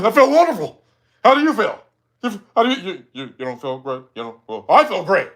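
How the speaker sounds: put-on voice of the person being mocked; exaggerated mock character voice; mocking role imitation. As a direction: imitate them insisting all is wonderful. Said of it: "I be going like".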